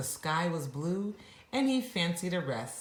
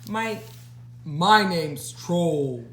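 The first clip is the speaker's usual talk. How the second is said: slow, proudly puffed-up troll; a mildly pompous troll introducing himself; troll puffing up with pride; 6 dB louder, much slower than usual. Acted. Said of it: "he proudly puffed".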